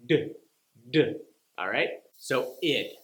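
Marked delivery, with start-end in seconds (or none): 0.1-1.1 a voiced d; d sound. Shown as a demonstration. Teacher demonstrating the D sound. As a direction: tap it with voice, d, d. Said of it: "turning on the vocal cords".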